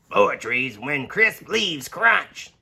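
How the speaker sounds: slow, distinct animal voice; unhurried critter; slow squirrel voice. Acted. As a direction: savour each crunchy word like a squirrel. Squirrel character voice.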